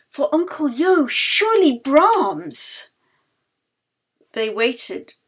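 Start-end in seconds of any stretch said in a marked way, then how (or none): 0.1-2.9 excited guess. Acted.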